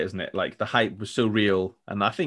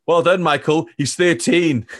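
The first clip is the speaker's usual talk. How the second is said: louder, higher, fed-up goalkeeping coach; hammed-up sarcastic praise, louder; mock exasperated Neville Southall; 7 semitones higher, 8 dB louder, slower than usual. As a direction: snap it out like a coach who's lost all patience. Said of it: "Neville Southall just goes".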